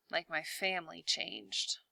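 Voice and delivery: soft half-swallowed mutter. A narrator's reading. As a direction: mutter it to himself while typing. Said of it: "Ben mutters".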